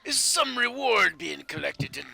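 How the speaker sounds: hearty laughing dwarf. Acted. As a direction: roar it out with a laugh. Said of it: "He says".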